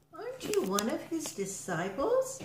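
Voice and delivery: put-on high-pitched child's question; storyteller voicing a girl, pitched high; little girl. A narrator's reading. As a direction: ask like a curious little girl. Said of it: "A little girl was there too".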